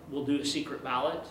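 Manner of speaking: ordinary talk, nothing marked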